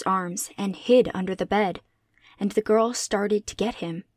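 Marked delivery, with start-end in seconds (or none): none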